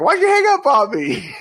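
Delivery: high, loud mock whine; indignant friend. Annie calling back after the hang-up, high loud mock indignation. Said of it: "She's like".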